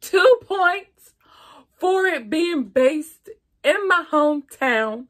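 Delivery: excited. Real, not acted. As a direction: burst out happily about your hometown. Excited about her hometown Philly.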